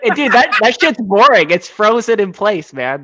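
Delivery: high, loud laughing voice. Real, not acted. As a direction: laugh through the words. Laughing reply to a joke.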